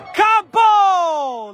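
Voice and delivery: high shout of the player's name. Real, not acted. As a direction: call out the shot. Shot by Campo, shouted name. (nome urlato in telecronaca)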